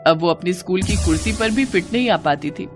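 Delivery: plain reading voice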